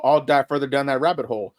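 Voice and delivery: usual speaking voice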